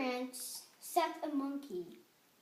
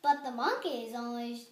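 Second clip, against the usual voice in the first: plain reading voice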